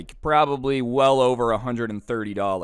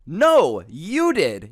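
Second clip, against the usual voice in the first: indignant retort; 4 semitones higher, 6 dB louder, much slower than usual. Retold or imitated. Arguing back at rude customer.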